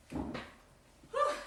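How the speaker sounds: high airy whew with a laugh; breathless whew. Real, not acted. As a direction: blow out a tired laughing whew. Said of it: "I'm out of breath".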